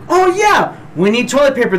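mock sudden realization. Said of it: "I was like".

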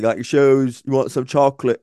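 playful Southern character imitation; Forrest Gump. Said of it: "The voice is going to come out".

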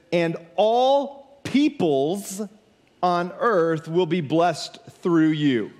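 very slow, weighty delivery with a pause mid-phrase; scripture reading. Acted. Preacher reading the key promise to the congregation, very slow and emphatic. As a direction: stretch each word out for emphasis.

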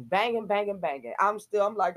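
normal talking voice

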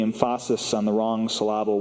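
"emphasis" as em-FAH-sis, "syllable" as suh-LAH-bul; deliberately misplaced stress. Shown as a demonstration. Preacher illustrating Christians' misplaced focus, "emphasis" and "syllable" each stressed on the second syllable.

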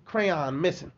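ordinary talk, nothing marked

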